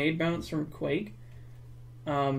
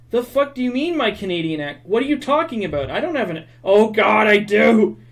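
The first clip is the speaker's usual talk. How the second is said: loud, higher, mock-indignant outburst; retold freak-out; 6 semitones higher, 11 dB louder than usual. Streamer retelling her reaction to a Canadian accent remark, mock outrage. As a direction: act out freaking out, building to a loud realization. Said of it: "I fucking freaked out".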